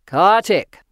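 loud, commanding voice from above; deep powerful voice. A narrator's reading. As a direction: call the name out with booming authority. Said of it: "deep and powerful".

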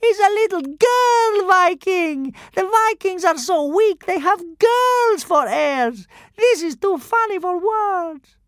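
high, loud, slow mocking voices; jeering Roman crowd. A narrator's reading. Roman spectators mock the Vikings in a loud high jeer. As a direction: laugh down at the girl Viking. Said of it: "they cried".

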